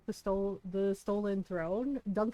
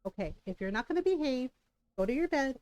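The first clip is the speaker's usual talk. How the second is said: ordinary talk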